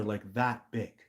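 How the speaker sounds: ordinary talk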